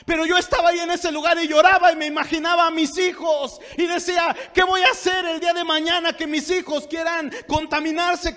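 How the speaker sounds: high, loud impassioned testimony; full-force preaching. Real, not acted. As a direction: cry out the plea from the pulpit. (testimonio predicado a gritos)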